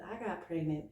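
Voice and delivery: ordinary talk, nothing marked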